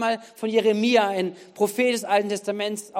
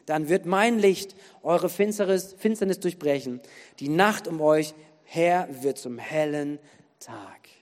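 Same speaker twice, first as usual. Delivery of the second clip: solemn scripture close; 4 semitones lower than usual. Acted. Preacher ends Isaiah's promise of light, solemn falling close. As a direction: let the reading settle into a hushed final word.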